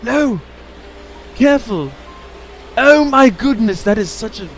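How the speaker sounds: sudden high-pitched alarm; alarmed high cry. Real, not acted. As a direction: yelp out a warning. Game near-disaster.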